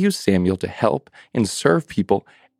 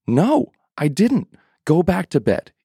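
put-on irritated old priest; 8 semitones higher than usual. Eli refusing Samuel a third time, exasperated. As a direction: snap at him to go back to bed.

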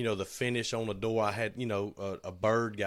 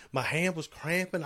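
lightly voiced higher-pitched coworker; imitated coworker; 7 semitones higher than usual. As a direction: pitch it up slightly to mimic him. Acting out coworker's reply.

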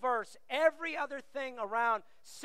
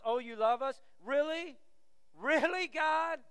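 put-on teenage attitude; 3 semitones higher, slower than usual. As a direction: challenge it like a doubting teenager. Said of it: "I want you to hear the attitude".

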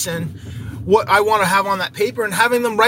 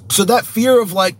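usual speaking voice

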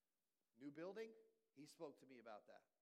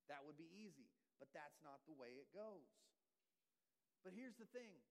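ordinary talk, nothing marked

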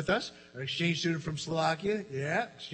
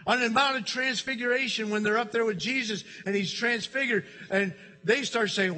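usual speaking voice

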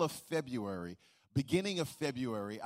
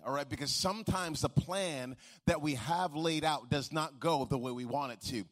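ordinary delivery

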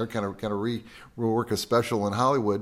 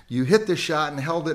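ordinary delivery

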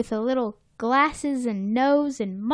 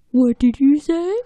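louder put-on questioning voice; prompting Plankton; 3 semitones higher, 6 dB louder than usual. Plankton asking SpongeBob to say it back, goading. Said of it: "Plankton goes".

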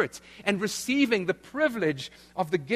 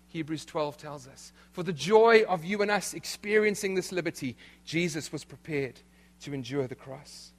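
ordinary delivery

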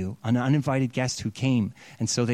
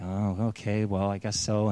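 reluctant agreement; 6 semitones lower, 6 dB quieter than usual. Retold or imitated.

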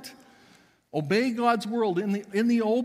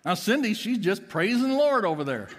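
usual speaking voice

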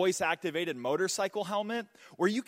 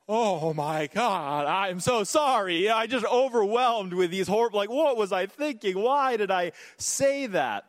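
mortified apology; 4 semitones higher, 4 dB louder than usual. Retold or imitated. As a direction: replay the cringe moment in full panic. Retells embarrassed apology to pastors.

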